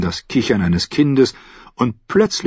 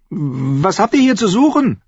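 put-on high young boy's voice; high, imperious little lord; demanding boy; 11 semitones higher than usual. A narrator's reading. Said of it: "verlangte er zu wissen".

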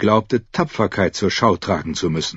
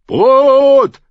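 high, long crowd bellow; roaring; 8 dB louder than usual. A narrator's reading. Hungry mob to the king, roaring. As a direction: bellow it as one long cry. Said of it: "brüllten die Menschen".

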